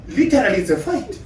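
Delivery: ordinary talk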